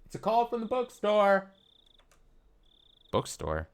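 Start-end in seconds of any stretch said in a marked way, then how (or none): 0.0-1.5 mother's voice. Acted. Voicing mother calling upstairs.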